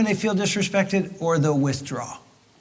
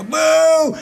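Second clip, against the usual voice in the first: much higher shouted boo; playful loud shout of boo; 12 semitones higher, 5 dB louder than usual. Retold or imitated. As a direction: heckle the wife on stage. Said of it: "and I go".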